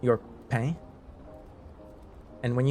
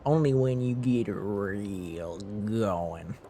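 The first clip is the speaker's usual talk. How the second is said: a light Southern twang, drawn out slowly at the end; a hint of a Southern accent, slowing down; mock Southern accent; slower than usual. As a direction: slip into a light Southern drawl. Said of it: "put on a good Southern accent".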